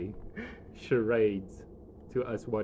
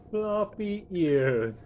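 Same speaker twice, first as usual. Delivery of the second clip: laughing; 7 semitones higher, 4 dB louder, much slower than usual. Real, not acted. GM repeats, laughing.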